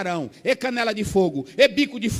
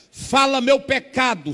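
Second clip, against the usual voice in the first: slow, louder demand to reveal his sin; slow, louder retold challenge; commanding challenge; 4 semitones higher, 6 dB louder, slower than usual. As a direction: dare her to speak, slow and firm. Pastor challenges false prophetess.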